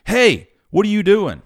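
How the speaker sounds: loud, high call across the road; shouting across the street. Retold or imitated. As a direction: call out to get his attention. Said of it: "he shouts across the street".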